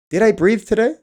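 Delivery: suddenly notice you forgot to breathe; surprised realization. Retold or imitated. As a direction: pitch jumps high in mock surprise.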